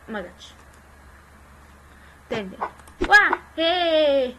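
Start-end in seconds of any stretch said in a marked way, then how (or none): 3.1-4.3 loud high whoop of joy; excited shout. Real, not acted. Gamer winning a prize shirt, loud joyful whoop. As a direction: yell out the win.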